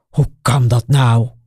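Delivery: dropped low, baffled question; genuinely astonished young man; truly surprised. A narrator's reading. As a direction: caught off guard, disbelieving. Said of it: "Hij is echt verbaasd".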